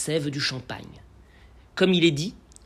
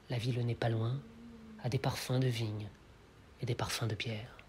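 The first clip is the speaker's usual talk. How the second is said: hushed reading, sinking lower and softer; poem recitation; 3 semitones lower, 5 dB quieter than usual. Acted. Lecturer reads Rimbaud's evening promenade verses, soft poem recitation. Recites the poem's first chapter.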